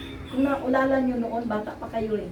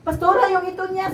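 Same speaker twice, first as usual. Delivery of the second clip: urgent plea; 8 semitones higher than usual. Retold or imitated. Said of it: "Dalawang beses talaga".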